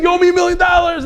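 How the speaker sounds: a gleeful shout claiming the prize; screaming. Retold or imitated. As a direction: scream it at the whole room in triumph. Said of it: "I'm screaming".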